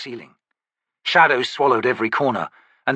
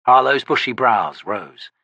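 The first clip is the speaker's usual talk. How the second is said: ordinary delivery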